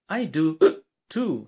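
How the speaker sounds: a sharp hiccup gasp in the middle of the words; little bear hiccuping while he talks. Acted. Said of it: "You have the hiccups".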